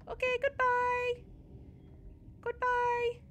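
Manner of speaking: put-on squeaky childish voice teasing Papa; childlike voice. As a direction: play a giddy child calling Papa. Voices a childlike game figure.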